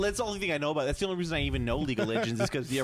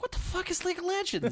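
much higher laughing disbelief; mock disbelief, laughing. Confused browser, in laughing high disbelief. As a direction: squeal it through laughter. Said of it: "I'm like".

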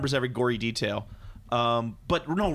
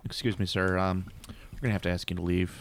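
low, hushed official voice; politely eject a player as the pit boss; lowered, discreet casino-staff voice; 5 semitones lower than usual. Acted. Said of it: "you have the pit boss come over".

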